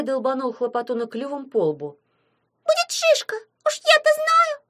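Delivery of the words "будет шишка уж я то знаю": very high, loud squeaky verdict; know-it-all imp. A narrator's reading. Imp judging the peck on the forehead, know-it-all squeak. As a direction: pipe it up like a tiny expert. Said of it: "с видом знатока заявила вреднючка".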